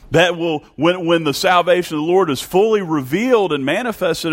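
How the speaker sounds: high, impassioned pulpit delivery; fervent preaching. Real, not acted. Preaches at full force.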